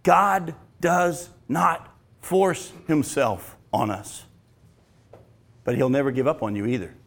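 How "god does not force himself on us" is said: slow solemn. Real, not acted. Solemn key point.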